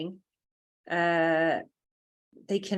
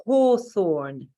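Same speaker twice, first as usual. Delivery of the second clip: Hawthorn in a crisp British accent, no R, the t-h spoken; 5 dB louder than usual. Shown as a demonstration. Teacher showing how the English say Hawthorn, in British accent. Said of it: "with my lovely British accent".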